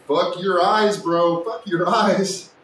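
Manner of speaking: hammed-up dismissive friend, last part slowed; mock friend. Streamer mimicking a viewer brushing him off, mock loud. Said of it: "now he's like".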